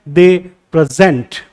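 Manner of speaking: slow "They present", weight on ZENT; second-syllable stress. Shown as a demonstration. Teacher demonstrates the verb, "present" stressed on its second syllable. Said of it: "तो ज यहाँ stress दे रहा हूं".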